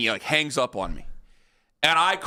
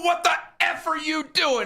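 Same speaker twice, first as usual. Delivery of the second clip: higher, retold angry "What the F are you doing?"; mock furious demand; 12 semitones higher than usual. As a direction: replay the phone confrontation. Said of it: "I go".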